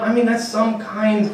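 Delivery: ordinary talk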